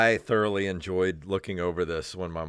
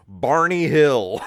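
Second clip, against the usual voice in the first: Barney Hill said slowly and louder, savoring the name; drawn-out "Barney Hill", a bit louder; mock emphasis; 5 semitones higher, 8 dB louder, slower than usual. Real, not acted. Friend repeats the principal's funny name, slow and savoring.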